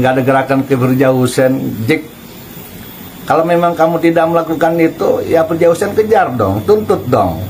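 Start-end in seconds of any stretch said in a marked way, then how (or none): none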